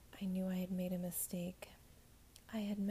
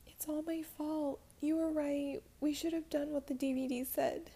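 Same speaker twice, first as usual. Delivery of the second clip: pleading, on the edge of tears; choked-up apology; tearful self-blame; 8 semitones higher, 4 dB louder than usual. Acted. Jess blaming herself, near tears.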